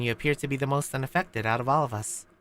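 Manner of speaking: ordinary delivery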